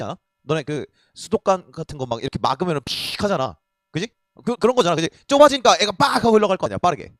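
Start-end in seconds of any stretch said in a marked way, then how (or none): 2.8-3.2 drawn-out hissing "pshh" of escaping water; sharp spurting noise; imitated water spurt. Imitating water spurting from narrowed pipe. 5.9-6.2 loud, drawn-out burst of rushing flow; forceful whoosh word, punched out loud; imitated gushing noise. Imitating blood rushing through narrowed vessel.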